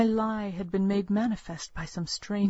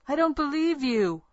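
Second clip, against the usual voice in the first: shouted; 7 semitones higher, 4 dB louder than usual. Acted. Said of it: "I shouted at her".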